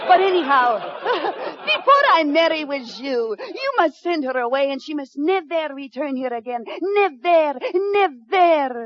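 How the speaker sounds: put-on French lady, a bit hammed-up; fake French accent. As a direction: play a fake French mademoiselle with a thick French accent. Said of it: "she has a decided French accent".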